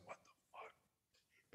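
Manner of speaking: slow, breathless whisper; mock stunned whisper; whispered disbelief. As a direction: whisper it slowly, dazed. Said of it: "it was just like".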